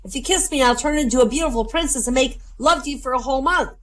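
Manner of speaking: high, urgent frog squeal; mock screaming. Talking frog pleading from a pocket, high mock scream. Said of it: "and the frog's screaming".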